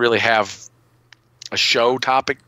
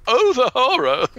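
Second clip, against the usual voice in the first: hammed-up horror-movie title read; melodramatic horror cry; 14 semitones higher than usual. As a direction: overdramatic mock dread, pitched way up. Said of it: "you gotta say it like that".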